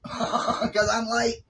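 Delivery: high, slow laughing drawl of the words; laughing singsong. Acted. Vlogger laughing to viewers about always being late, high and slow. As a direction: sing it out slowly through a laugh. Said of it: "Girl, 'cause I'm late".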